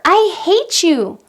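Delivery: put-on high, loud, slow hurled insult from a kid; child lashing out. Coach voicing a child insulting the parent, high and slow. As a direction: throw it at the parent like an upset child. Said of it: "they throw something at us like".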